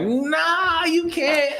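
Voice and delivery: high-pitched imitation of objecting ladies; playful, squeaky-high objection; mock protest. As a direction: voice their refusal way up high. Voicing ladies' objection to saving.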